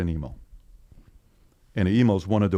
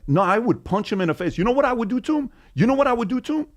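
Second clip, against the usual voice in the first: much higher, hammed-up challenge; mock bravado; 13 semitones higher, faster than usual. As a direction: squeak up the pitch like a blustering hothead. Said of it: "And then you're like".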